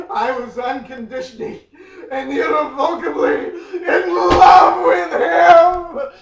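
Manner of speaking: sobbing shout. Acted. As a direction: wail the love confession, overcome. Climax of dramatic reading.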